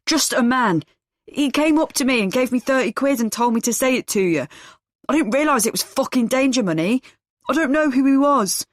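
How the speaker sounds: pinned man pleading in fear with his captor; scared. A narrator's reading. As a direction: blurt it all out in a panic. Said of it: "He's really scared now".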